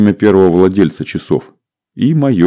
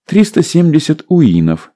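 stewardess voice; 9 semitones higher than usual. A narrator's reading.

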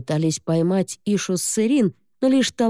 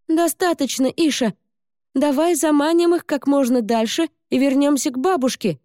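calling out excitedly; 9 semitones higher than usual. Acted. Girl calls to cat.

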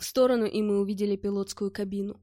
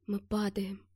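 breathless whisper of dread; frightened whisper; 2 semitones lower, 6 dB quieter, slower than usual. A narrator's reading. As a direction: whisper it, barely daring to say. Realising the helicopter falls. (испуганный шёпот)

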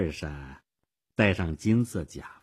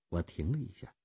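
usual speaking voice

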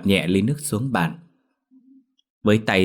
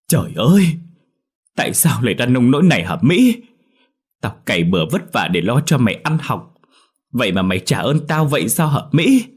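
anguished, higher, rising into wails; tearful reproach; 5 semitones higher, 5 dB louder than usual. A narrator's reading. Mother betrayed by her pregnant daughter, wailing in reproach. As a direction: lament loudly and accusingly. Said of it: "Bà Lựu đánh mạnh vào người cô, rưng rưng nước mắt".

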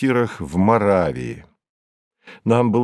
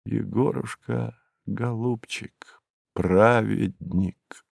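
медленный, очень тихий шёпот с паузами; прошепчи ласковые слова, растягивая каждое; 7 dB quieter, slower than usual. A narrator's reading. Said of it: "прошептал". (скорбно и медленно шептать)

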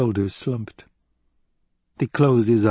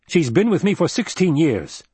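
higher-pitched, indignant defence; heated protest; 7 semitones higher, 4 dB louder than usual. A narrator's reading. Duke defending his woman to a suspicious adviser, heated. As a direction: argue back hotly, voice raised in pitch.

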